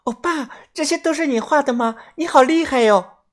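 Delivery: put-on very high, admiring girl voice; girlish amazement. A narrator's reading. Girl admires the narrator's drawing, high amazed voice. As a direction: marvel in a high, sweet girl's voice. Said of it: "惊叹地说道".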